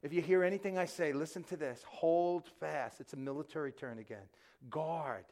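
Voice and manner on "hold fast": normal talking voice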